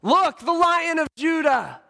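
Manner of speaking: high, loud herald's cry; loud proclamation. Acted. Preacher announcing the Lion of Judah, high ringing shout. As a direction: call it out for all to behold.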